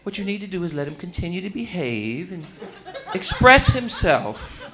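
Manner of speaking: a mock soothing expert; calm psychologist. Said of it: "The child psychologist is like".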